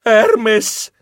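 high, loud nervous protest; flustered denial. Acted. Flustered denial to accusing warriors.

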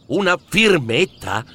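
usual speaking voice

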